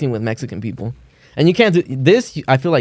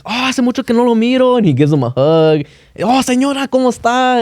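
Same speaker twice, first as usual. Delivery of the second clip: high, gushing welcome; overjoyed greeting; 10 semitones higher than usual. Retold or imitated. Family greeting strangers at swap meet, high gushing. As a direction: gush over long-lost friends.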